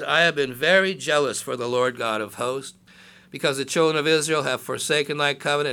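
loud, declaiming Bible reading; scripture reading. Acted. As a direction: proclaim the verses to the congregation. Reads scripture aloud.

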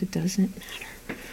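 hushed whisper; breathy throwaway whisper; whispered aside. Real, not acted. Aside after "counts just as much.

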